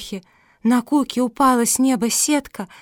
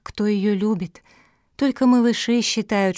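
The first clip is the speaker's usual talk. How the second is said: plain reading voice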